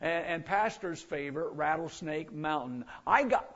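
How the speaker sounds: normal talking voice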